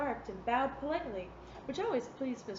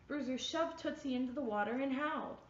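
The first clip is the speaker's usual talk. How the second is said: usual speaking voice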